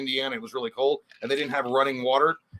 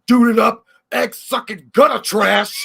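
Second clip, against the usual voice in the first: loud, high, drawn-out insult; hammed-up film outlaw insult; sling it out loud and slow, half laughing; movie tough-guy impression; 8 semitones higher, 9 dB louder, slower than usual. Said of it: "sounds like the fucking dude from Tropic Thunder".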